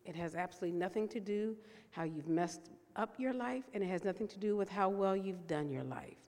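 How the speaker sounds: usual speaking voice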